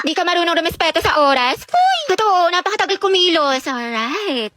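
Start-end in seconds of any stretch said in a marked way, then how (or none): 1.6-2.0 high sharp shout of hey; shrill-free sudden call; sudden yell. Acted. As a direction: yell it out in surprise. Friends teasing, sudden yell.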